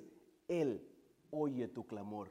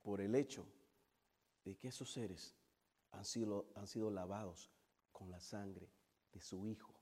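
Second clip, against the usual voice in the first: low, soft, phrase by phrase; quiet, solemn pulpit aside; hushed reverence; 6 semitones lower, 7 dB quieter than usual. Real, not acted. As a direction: bring it right down and let each phrase settle. Preacher drops to hushed reverence.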